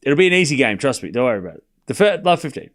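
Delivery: usual speaking voice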